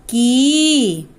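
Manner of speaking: की said again with a long ee; long ee sound. Shown as a demonstration. Teacher repeating for children the long vowel, drawn out. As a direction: hold the ee in की long. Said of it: "दीर्घ ई, बड़ी ई की मात्रा".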